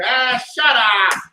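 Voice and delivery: slow, loud drawn-out shut up; cartoon catchphrase. Repeating his new catchphrase, slow and loud. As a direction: stretch it like the cartoon. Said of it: "That's gonna be my new joint".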